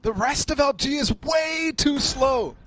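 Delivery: excited shout. Real, not acted. Co-caster urgent call.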